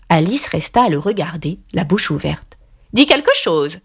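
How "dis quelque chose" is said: bossy queen. A narrator's reading. Red Queen prods speechless Alice.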